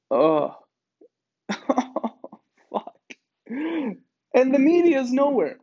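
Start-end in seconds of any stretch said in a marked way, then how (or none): 4.3-5.6 louder, higher indignant declaration of "nowhere"; emphatic. Real, not acted. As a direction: slam it out with outrage. Repeated line, first emphatic.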